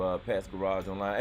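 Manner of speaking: ordinary talk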